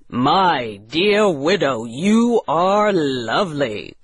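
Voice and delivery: admiring character voice, swooping tune; Colonel Killigrew. Acted. Colonel to the widow as age disappears, admiring voice. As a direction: admire her in a put-on colonel's voice. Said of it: "cried Colonel Killigrew".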